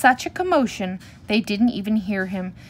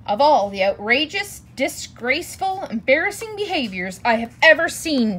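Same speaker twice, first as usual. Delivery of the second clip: mild outraged roar with raised pitch; angry roar; 5 semitones higher, 4 dB louder than usual. A narrator's reading. Papa bear fuming over the cubs' behavior, roaring. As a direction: hit each adjective with fatherly indignation. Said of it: "he roared".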